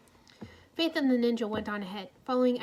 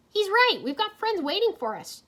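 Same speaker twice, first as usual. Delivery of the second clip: Jay's voice; 10 semitones higher, 7 dB louder than usual. A narrator's reading. Jay voiced eager.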